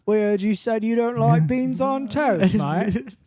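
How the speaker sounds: high, drawn-out bad British accent; British accent slipping toward Australian; mock British guard. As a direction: confront them as an offended British guard, slowly. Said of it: "the royal guards are gonna be waiting".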